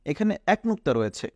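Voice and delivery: ordinary delivery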